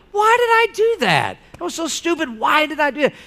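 hammed-up frustration at your own mistake; mock self-scolding. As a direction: scold yourself in a high, fed-up voice. Mock exasperated self-rebuke.

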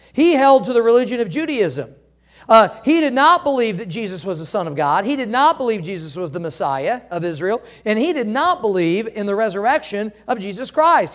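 ordinary talk

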